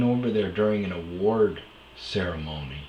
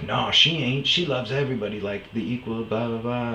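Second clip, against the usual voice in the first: dismissive ex; 5 semitones higher, 5 dB louder than usual. Retold or imitated. Ex defending sister.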